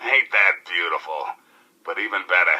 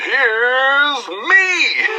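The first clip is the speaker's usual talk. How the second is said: laughing DJ; 14 semitones higher than usual. Acted. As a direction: crack yourself up as you announce yourself. DJ laughs into the news intro.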